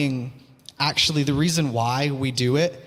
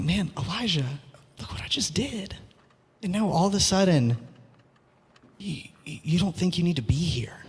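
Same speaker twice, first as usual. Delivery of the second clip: soft whispered God, much softer; gentle voice of God; 3 dB quieter than usual. Retold or imitated. God whispers gently to the despairing Elijah, tender and hushed. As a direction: lean in and whisper like a kind father.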